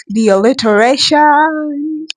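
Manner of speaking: gushing over the sound of the line; excited. Real, not acted. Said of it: "Okay, calm down".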